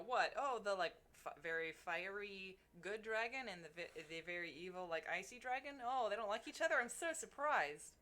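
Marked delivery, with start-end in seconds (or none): 5.9-7.9 mock surprise, dry; sarcastic. Real, not acted. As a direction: act amazed at the obvious. Sarcastic reaction to obvious fact.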